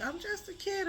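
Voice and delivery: a light hint of the crying child; imitating a child. Retelling what the child said, in a high, soft child voice. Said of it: "the child was like".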